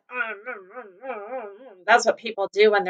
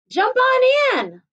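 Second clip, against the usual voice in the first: much higher, slow call; eager invite; 12 semitones higher than usual. Acted. Pig calls Cow into the pond, high and eager. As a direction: coax her into the water.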